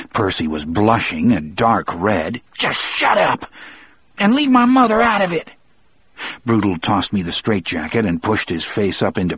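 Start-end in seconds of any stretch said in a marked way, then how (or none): 2.5-5.5 high, strained indignant voice; angry retort. A narrator's reading. Blushing Percy defending his mother to Broodle, high angry retort. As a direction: protest high, stung and humiliated.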